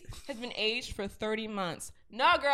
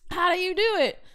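higher, louder echoed question; excited echo; 9 semitones higher, 6 dB louder than usual. Real, not acted. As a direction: bounce the question back brightly.

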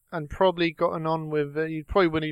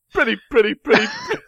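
high squeaky mimicking voice; imitation of his voice; 6 semitones higher, 5 dB louder than usual. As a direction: copy his silly singsong, pitched way up. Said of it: "You sound like me, too".

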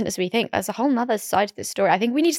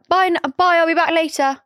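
mock breezy goodbye; 7 semitones higher, 3 dB louder than usual. Mock cheery goodbye to friend.

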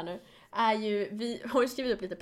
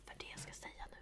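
unvoiced secretive whisper; 20 dB quieter, faster than usual. Real, not acted. Whispering a secret to her co-host, unvoiced. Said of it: "Nej, jag ska inte viska".

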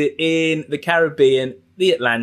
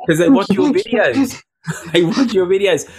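giggling through the words, higher; laughing; 6 semitones higher, 4 dB louder than usual. Real, not acted. Host laughing at the guest's joke, giggly and high. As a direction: laugh it out, amused.

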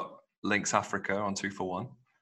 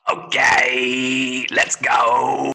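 loud high hype man shout; 5 semitones higher, 10 dB louder, much slower than usual. Acted. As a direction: hype up the crowd like an MC. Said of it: "That's an impression of [name] or Joanna's bladder".

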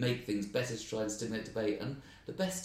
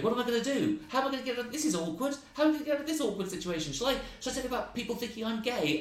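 much higher, hammed-up flapping voice; comic dancing around the stage, much higher and fast; mock panic; 13 semitones higher, 3 dB louder, faster than usual. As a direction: squeal it like a panicking clown. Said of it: "started dancing around the stage, going".

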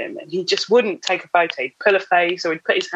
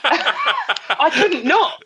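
slow, laughing and high; giggling confession; 9 semitones higher, much slower than usual. Real, not acted. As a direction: drag it out through a laugh. Laughing admission.

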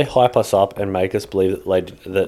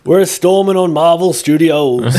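mock battle cry; 8 semitones higher, 5 dB louder than usual. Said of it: "It's just".

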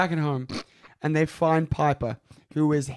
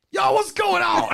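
loud, high-pitched comic shout; Bernie Mac; 14 semitones higher, 5 dB louder than usual. Acted. Called in by the hosts, hammed-up comedian impression. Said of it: "bring Bernie Mac in to do these voices".

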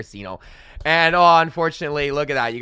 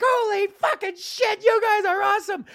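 amazed fan; 5 dB louder than usual. Retold or imitated. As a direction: gush at the band in disbelief. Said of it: "I'm like".

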